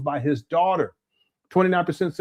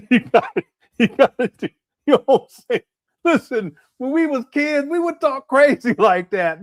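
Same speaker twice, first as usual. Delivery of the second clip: laughing; 8 semitones higher, 4 dB louder than usual. Real, not acted. Cracking up defending Umar.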